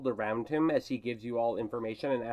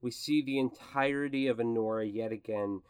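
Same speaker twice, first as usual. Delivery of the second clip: game master's scene setting; 4 semitones lower than usual. Acted. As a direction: narrate a closing camera pull-back in a lowered voice. Game master sets closing scene.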